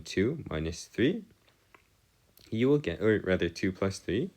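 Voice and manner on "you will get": normal talking voice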